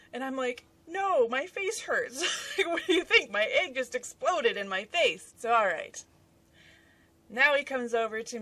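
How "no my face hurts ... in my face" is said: high, exasperated comeback with laughter; mock-whiny retort. Wife answering husband asking if she's okay, laughing mock complaint.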